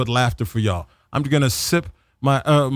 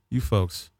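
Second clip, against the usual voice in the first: ordinary talk, nothing marked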